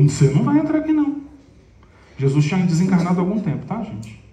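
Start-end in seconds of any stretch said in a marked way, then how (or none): 0.0-1.2 much higher, louder, shutting the door on him; harsh refusal. Retold or imitated. Pedro turning away the thief at the door, harsh refusal. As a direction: snap it out as a stern gatekeeper. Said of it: "Pedro falou".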